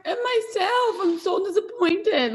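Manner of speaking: high, tearful voice on the edge of crying; real disappointment, about to cry; a mother in Orlando admits she is near tears; close to tears. Real, not acted. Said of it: "I'm about to cry".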